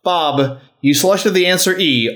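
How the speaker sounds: ordinary talk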